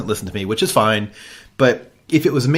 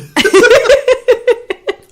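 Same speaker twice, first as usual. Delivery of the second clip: loud high cackle of glee; laughing at his own story, high and loud; high burst of laughter; 9 dB louder than usual. Real, not acted. Laughing at the retold moment.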